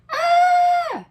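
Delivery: high piercing shriek of alarm; startled scream. A narrator's reading. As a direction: scream in shock at the baby. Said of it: "they both yelled".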